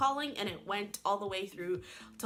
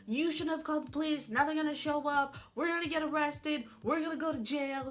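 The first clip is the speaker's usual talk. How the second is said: blaming; 6 semitones higher than usual. Retold or imitated. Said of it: "she's bawling while I'm out here".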